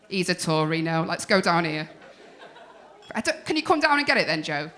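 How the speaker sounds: ordinary delivery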